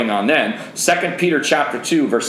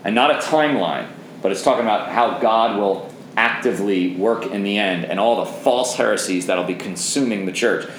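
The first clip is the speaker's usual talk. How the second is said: normal talking voice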